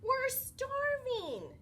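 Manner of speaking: high, slow, drawn-out whine; hungry friends complaining together; whiny kids. Acted. Friends lost their lunches.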